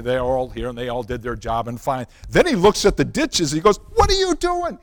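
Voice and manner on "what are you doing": high-pitched imitated angry scream; screaming boss. Boss sees the badly dug ditches, screaming. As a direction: shriek it like an outraged boss. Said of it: "he starts screaming at me".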